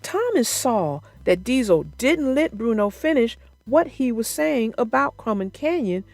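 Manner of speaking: ordinary delivery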